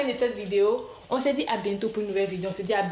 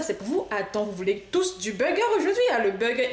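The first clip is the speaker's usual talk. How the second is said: usual speaking voice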